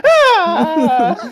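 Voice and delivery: high, loud laughing shriek; frightened yelp. Retold or imitated. Friend imitating the scared dwarf, high laughing yelp. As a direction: squeal like a startled little man.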